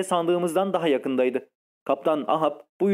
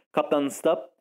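plain reading voice

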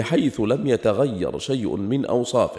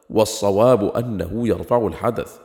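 normal talking voice

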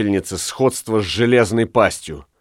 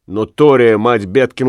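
plain reading voice

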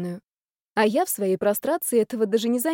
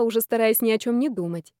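ordinary delivery, nothing marked

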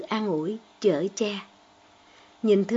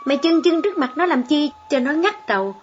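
louder, higher angry scolding; yelling mother; 4 semitones higher, 5 dB louder than usual. Acted. Mother yelling at her son over the cricket, loud and angry. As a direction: tear into the boy at full volume. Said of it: "cô Bảy Hợi hét thằng Hơn".

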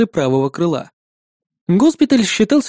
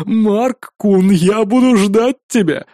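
chuckle, then a slow teasing promise; smirking; 2 semitones higher, much slower than usual. A narrator's reading. Dancer answering the postponed challenge, smirking. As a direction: toss it back with a smirk, slowing down. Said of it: "усмехнулся любитель танцев в ответ".

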